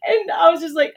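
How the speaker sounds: usual speaking voice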